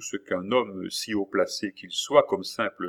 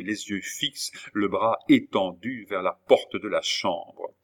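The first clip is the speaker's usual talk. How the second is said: usual speaking voice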